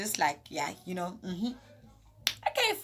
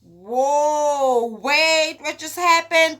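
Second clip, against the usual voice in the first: louder, higher disbelief; shocked; 5 semitones higher, 8 dB louder, much slower than usual. Retold or imitated. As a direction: catch yourself in shock. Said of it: "And I'm like".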